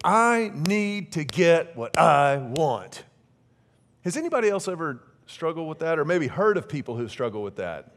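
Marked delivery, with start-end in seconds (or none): 0.0-3.0 very slow, drawn-out inner demand; inner voice wanting. Retold or imitated. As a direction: stretch each word like a stubborn craving. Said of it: "inside of me that said".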